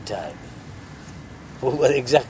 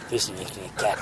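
lower, softer begging mouse; meek mouse plea; 7 semitones lower, 7 dB quieter than usual. Acted. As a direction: plead low and small like a frightened mouse. Mouse pleads to the sage.

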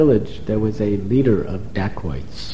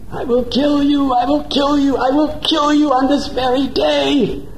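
high furious screaming threat; screaming goddess; 13 semitones higher, 3 dB louder than usual. Acted. Goddess Chandi threatening the dacoit leader in a dream, furious scream. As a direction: shriek it as a wrathful goddess. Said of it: "She was screaming".

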